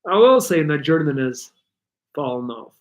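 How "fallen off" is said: ordinary delivery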